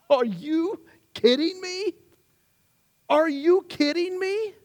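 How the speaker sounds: high, incredulous cry of amazement; stunned at its own new wings; amazed disbelief. Acted. Caterpillar voiced seeing its wings.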